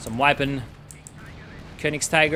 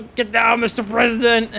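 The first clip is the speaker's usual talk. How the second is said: playful bodyguard cry, higher and louder; mock bodyguard shout; 8 semitones higher, 6 dB louder than usual. Caster jokes that the Puma shields the Jagdtiger, mock bodyguard shout. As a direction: ham up a movie bodyguard diving in. Joking quote for sacrificed Puma.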